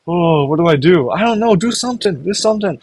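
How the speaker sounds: high, panicky pleading; frantic and helpless, begging for help; panicked Mikasa. Acted. Frozen girl begging someone to act, high and panicked.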